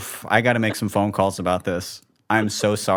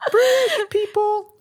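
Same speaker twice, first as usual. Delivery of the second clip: slow helium squeak; absurdly high, drawn-out falsetto; high-pitched; 4 dB louder, slower than usual. Shown as a demonstration. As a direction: stretch it out at the top of your range. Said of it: "how do I talk high-pitched and not in an accent".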